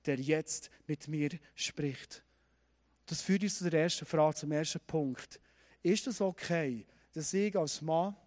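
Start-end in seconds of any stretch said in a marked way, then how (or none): 0.0-2.2 hushed, low, slowing entreaty; pleading. A narrator's reading. Gideon asks God for a sign, quietly pleading. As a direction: beg softly, spacing out the final words. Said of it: "er fleht".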